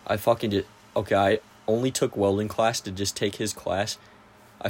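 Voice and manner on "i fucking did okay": usual speaking voice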